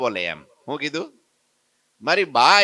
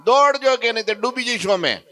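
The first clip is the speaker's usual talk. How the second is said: desperate shout; 10 semitones higher, 7 dB louder than usual. Acted. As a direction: yell across the water like you're drowning. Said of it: "નાથાએ બૂમ પાડી".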